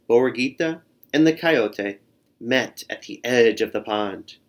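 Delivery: usual speaking voice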